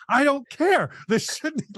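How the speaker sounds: a put-on indignant outburst, pitched high; imitating her anger. Imitating the actress raging that this scene exists, mock fury. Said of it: "she's just so mad that that exists".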